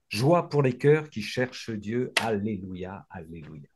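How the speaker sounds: hushed closing alleluia; fading prayer. Acted. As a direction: let the alleluia die away reverently.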